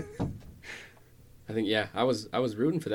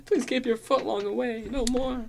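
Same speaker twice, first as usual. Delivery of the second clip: squeaky pleading voice; 12 semitones higher than usual. Pretend. Mock prisoner begging.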